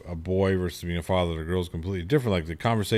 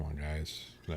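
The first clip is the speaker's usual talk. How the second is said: muttered inner thought; 3 semitones lower, 13 dB quieter than usual. Retold or imitated. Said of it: "I'm thinking".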